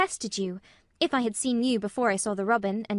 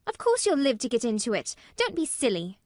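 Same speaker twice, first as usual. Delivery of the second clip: put-on brisk, scolding little girl, higher and louder; indignant snap; 4 semitones higher, 5 dB louder than usual. A narrator's reading. Mary telling Colin not to be silly, indignant snap. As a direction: put him straight indignantly. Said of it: "snapped Mary indignantly".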